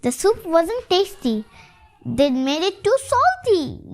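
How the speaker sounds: plain reading voice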